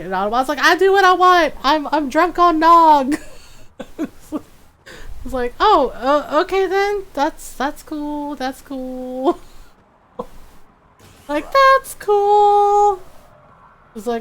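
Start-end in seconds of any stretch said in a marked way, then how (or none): 0.6-3.2 put-on loud, high drunken defiance; hammed-up tipsy rule-breaker; Automod. 5.6-9.4 hesitant, high, slow retreat; awkward, placating mock unease; mock backing down. Acted backing down before drunk Automod. 11.5-13.0 loud, high, stretched-out squeak; hammed-up strained acceptance; exaggerated placating. Exaggerated repeat of the placating reply.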